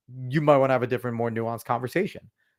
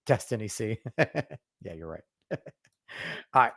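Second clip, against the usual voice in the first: ordinary delivery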